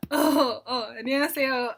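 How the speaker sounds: flustered greeting. Retold or imitated. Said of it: "I'm like".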